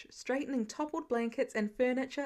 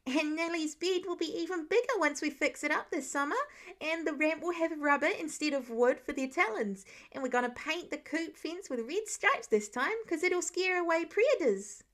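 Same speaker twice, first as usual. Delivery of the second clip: put-on rambling excited child; 4 semitones higher than usual. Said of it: "Nate rambled on".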